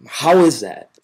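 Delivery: ordinary talk, nothing marked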